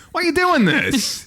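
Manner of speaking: high, drawn-out mock exasperation; exasperated retort. Mocked traveler fires back at map question, exasperated. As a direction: protest in a high, stretched voice.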